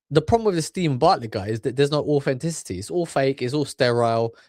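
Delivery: usual speaking voice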